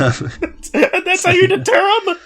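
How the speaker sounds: high squeaky laugh through the words; giggling it out, slowly. Real, not acted. Laughing at the joke.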